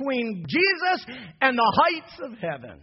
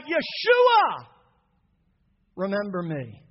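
crying out; 4 dB louder than usual. Acted. Dying thief on the cross calling out to Jesus. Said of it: "he cried out".

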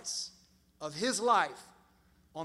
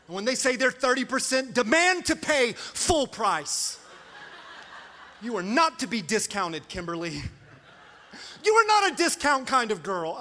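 much higher and louder, giddy and reckless; hammed-up spending frenzy; 6 semitones higher, 9 dB louder than usual. Husband urging his wife to spend all their savings, loud mock mania. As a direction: sell it like a crazed shopaholic. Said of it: "And I'm like".

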